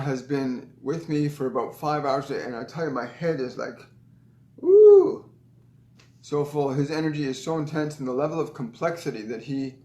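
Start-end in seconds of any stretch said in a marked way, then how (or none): 4.6-5.3 high, loud "woo"; sudden high whoop; overwhelmed woo. Shown as a demonstration. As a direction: let out a high woo like a spinning head. Mimics her overwhelmed head.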